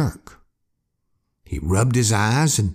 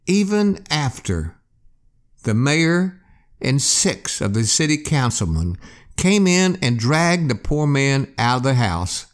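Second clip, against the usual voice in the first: plain reading voice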